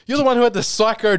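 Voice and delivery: high-pitched mock outrage; hammed-up exasperated comeback; indignant retort. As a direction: fire back in a comic lovers' quarrel. Acted quarrel with dreaming girlfriend.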